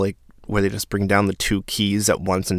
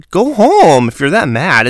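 loud, higher outburst; exasperated scolding; 7 semitones higher, 8 dB louder than usual. Acted. Telling off an angry new houseguest, exasperated and loud. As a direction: snap it out loudly at a sulker.

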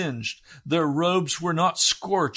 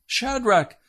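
higher, mock-grand summons of the wrong master; mock king's call; 8 semitones higher, 3 dB louder than usual. Preacher mocking what the king didn't say, lightly voiced. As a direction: ham up the king's proud call. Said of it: "he does not say".

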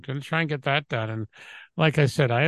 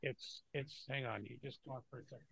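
far from mic; 21 dB quieter than usual. Real, not acted. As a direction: voice fading as he walks off. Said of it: "he walks away from the microphone".

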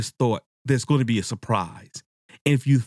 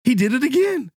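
amazed disbelief; 12 semitones higher than usual. Retold or imitated. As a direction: blurt it like you got fooled again. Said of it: "you're like".

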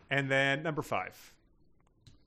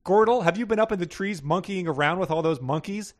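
raised-pitch call from the nursery; mild narrated yell; 7 semitones higher, 4 dB louder than usual. A narrator's reading. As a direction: nag a spouse from another room, mildly raised. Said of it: "Glendra Gorilla yelled from Bubo's nursery".

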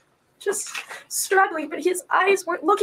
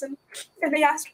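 normal talking voice

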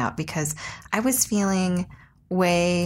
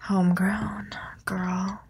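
whisper; slower than usual. Acted. Hyping weed to co-host.

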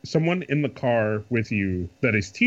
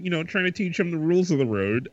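ordinary talk, nothing marked

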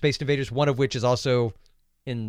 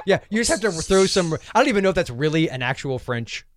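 ordinary talk

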